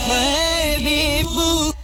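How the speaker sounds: ordinary talk, nothing marked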